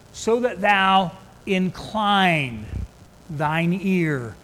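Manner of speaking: slow, leaning hard on receive, hide and incline; emphasis on key words. Shown as a demonstration. Preacher stressing scripture for the congregation, slow and emphatic. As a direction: weigh each word of the verse. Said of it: "I'm gonna put some emphasis upon some of these words".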